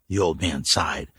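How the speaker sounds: ordinary delivery, nothing marked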